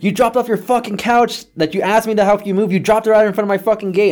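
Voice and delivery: outraged high-pitched phone rant; angry yelling. Retold or imitated. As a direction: imitate a father yelling his accusation. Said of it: "he's just yelling at me".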